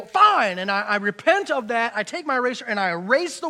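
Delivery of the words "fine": sharp, louder, higher "Fine"; exasperated fine. Retold or imitated. Preacher giving in to the bee, exasperated, loud and high. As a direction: snap it like you've given up on the bee. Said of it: "I, I go".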